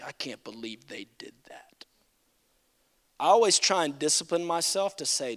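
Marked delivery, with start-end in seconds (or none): 0.0-1.8 mock self-righteous whisper.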